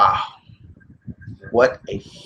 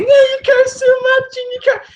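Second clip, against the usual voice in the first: high, whiny mock of a complainer; much higher mocking whine of viewers who complain; mocking a whiny critic; 6 dB louder than usual. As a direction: squeak it out like a nagging critic. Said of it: "To anybody who's saying".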